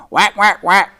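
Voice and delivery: high squawking whack; crying duck call. Acted. Mama Duck squawking after the fireman, frantic. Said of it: "she cried".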